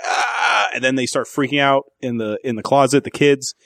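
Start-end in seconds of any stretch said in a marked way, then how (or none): 0.0-0.7 high mock scream; imitated scream. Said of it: "And she's like".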